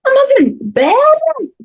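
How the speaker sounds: put-on high, loud little girl voice; child voice. Mum retelling her little girl's words in a high child's voice. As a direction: squeak it like a toddler announcing news.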